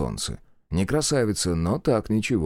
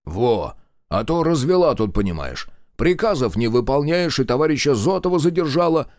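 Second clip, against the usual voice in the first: grumbling lecture; 6 semitones higher, 4 dB louder than usual. A narrator's reading. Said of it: "нравоучительно покряхтел командир".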